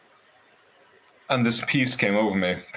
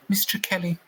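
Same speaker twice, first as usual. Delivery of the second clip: high-pitched mock psychiatrist voice; put-on high voice of an Irish psychiatrist; imitated psychiatrist; 12 semitones higher than usual. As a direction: pitch it well up, mimicking a doctor's probing question. Mimics the psychiatrist questioning him.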